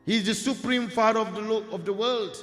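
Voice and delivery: proud declaration. Acted. Ganesh tells the warrior whose son he is, proud declaration. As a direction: proclaim it grandly, with rising force.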